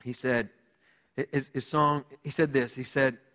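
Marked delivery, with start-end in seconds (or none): none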